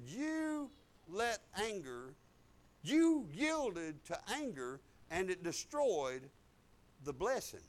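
measured and drawn out, phrase by phrase; slow, deliberate rebuke. Retold or imitated. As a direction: relay a correction slowly, letting each part land. God's slow rebuke retold.

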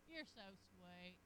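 low, slow and soft sweetness; tender compliment. Retold or imitated. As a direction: coo it slowly. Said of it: "he'll say".

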